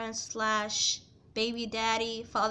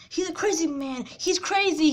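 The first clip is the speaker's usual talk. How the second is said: higher, louder, accusing; mock shouting stepmom; 5 semitones higher, 4 dB louder than usual. Stepmom shouting to the teen about her ex, mock yell. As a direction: imitate her yelling that he's crazy. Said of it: "he said".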